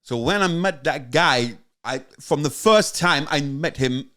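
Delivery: slow, accented impression of a documentary figure; drifting towards Christopher Walken; Tony Yester. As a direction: put on a slow, halting foreign-sounding voice. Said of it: "it's meant to be Tony Yester".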